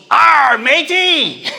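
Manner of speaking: slow, loud, drawn-out pirate call; pirate shout. Retold or imitated. A toddler greets an eye-patched stranger with a slow, loud pirate shout. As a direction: holler it like a gleeful little pirate.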